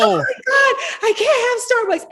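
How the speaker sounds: high, laughing wail about coffee; mock meltdown over no Starbucks, laughing. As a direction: whine it like a spoiled diva, laughing. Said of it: "I was like".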